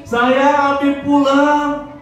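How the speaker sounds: high, loud and joyful; excited child. Pretend. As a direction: welcome dad home with delight. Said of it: "Tapi yang dirindukan seperti ini". (anak menyambut ayah dengan gembira dan nyaring)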